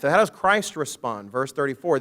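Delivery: usual speaking voice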